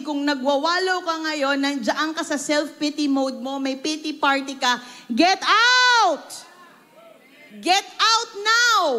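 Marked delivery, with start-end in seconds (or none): 5.1-8.9 loud preacher's command; forceful command. Real, not acted. Preacher orders the congregation out of self-pity, loud. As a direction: order them out of it.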